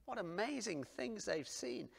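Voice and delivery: usual speaking voice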